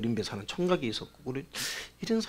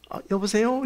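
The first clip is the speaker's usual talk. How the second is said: caller's hello; 7 semitones higher, 3 dB louder than usual. Retold or imitated. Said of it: "이러는 거예요".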